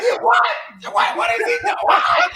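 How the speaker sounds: high incredulous what; laughing, playing Reggie's disbelief; mock disbelief. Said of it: "He was like".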